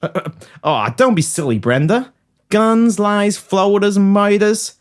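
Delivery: a New York private eye boasting, voice a little up; New York accent. Acted. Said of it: "you went several octaves higher".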